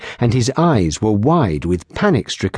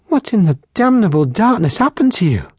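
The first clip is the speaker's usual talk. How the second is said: a higher, shocked cry of dismay; horrified; 10 semitones higher, faster than usual. Acted. Barmaid seeing his battered face, shocked and higher. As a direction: recoil at the sight of the scars. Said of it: "a tiny but horrified gasp".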